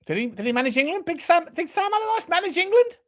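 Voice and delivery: high-pitched, incredulous question; astonished, voice shooting up. Real, not acted. Disbelief at claim Sam managed England.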